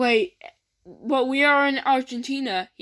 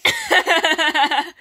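high burst of laughter; 5 semitones higher, 4 dB louder than usual. Real, not acted. Friend laughs loudly at a joke about Terry.

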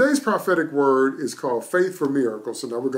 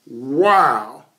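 amazed wow; 6 dB louder than usual. Acted. Preacher marvels at Elijah's boldness.

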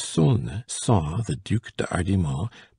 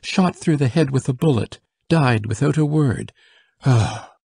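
higher, louder grieving report; distressed report; 5 semitones higher, 4 dB louder than usual. Acted. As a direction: break the news in distress. Soldier telling of death.

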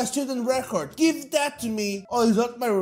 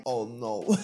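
quiet dread; 12 semitones lower, 5 dB quieter than usual. Acted.

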